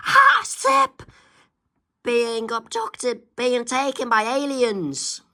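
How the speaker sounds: squeal, then drag the words out slowly; mock alarmed squeal. Kids being abducted by aliens, squealing high then slow.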